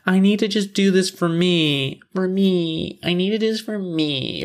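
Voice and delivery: high-pitched mock plea; mocking imitation. Mocking a character's repeated line.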